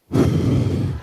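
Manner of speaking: hard exasperated exhale; loud huffy sigh. Shown as a demonstration. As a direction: breathe out your anger loudly. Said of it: "they just breathe out really hard".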